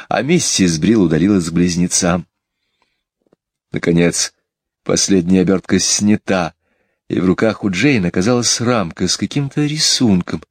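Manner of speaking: plain reading voice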